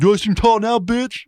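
high, loud comic taunt; mock defiant taunt. Shaved-head man to doubters, mock defiant taunt. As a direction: throw it out as a cocky challenge.

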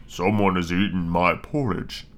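low, slow rough bear voice; great gruff bear. Acted. Great big bear finding his porridge eaten, rough gruff voice. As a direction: rumble it slowly, deep and gruff. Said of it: "great rough, gruff voice".

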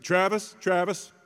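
high, loud reproving call; calling out sharply. Retold or imitated. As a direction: call the name to stop someone short. Wife calls out to stop him.

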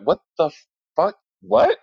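high, incredulous retold reaction; baffled. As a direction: act out total bafflement, pitch jumping on the last word. Baffled reply to friend's odd phrase.

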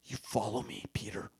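soft unvoiced summons; whispered call. Jesus whispering his call to Peter. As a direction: whisper the invitation. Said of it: "And then he said".

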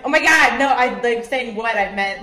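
loud retold protest, easing off toward the end; indignant retort. Woman retelling her reply to being shushed, indignant. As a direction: act out snapping back, loudest at the start.

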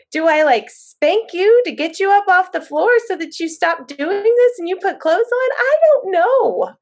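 put-on high, loud, helpless pleading to a child; exasperated pleading. Mom pleading with her crying daughter on the floor, high and exasperated. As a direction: throw up your hands and beg her.

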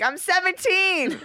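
high, drawn-out mock protest; retold protest. Teenager fending off bar promoters, high mock protest.

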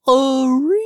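high, drawn-out nonsense squeal; silly mic screech. Retold or imitated. Imitating friends getting on the game mic, squealing nonsense. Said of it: "and you would just hear".

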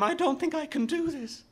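very high, panicked outburst; frightened boy losing control; rising panic. A narrator's reading. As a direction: let the panic climb into a high cry. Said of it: "the panic rising in him".

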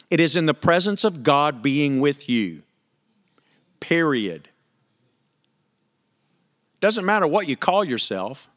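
usual speaking voice

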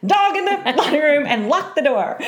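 half-laughing order shouted down the hall; retold yell. Mother yelling to sister-in-law to lock the dog up, laughing yell. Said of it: "So I scream to Brock's sister".

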